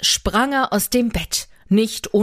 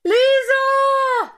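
calling out. Acted. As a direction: shout her name across the clearing. Calling out across the forest. (sehr hoher, lauter Ruf nach Lisa)

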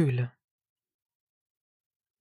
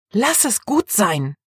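slow, higher hissed warning; 4 semitones higher than usual. Acted. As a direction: hiss it out as a sharp rebuke. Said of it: "zischte sie ihm zu".

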